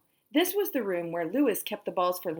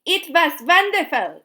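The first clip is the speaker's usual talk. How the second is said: loud, high delighted cry; exclaimed; 9 semitones higher, 10 dB louder than usual. A narrator's reading. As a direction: burst out in delight. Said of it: "exclaimed Kathy".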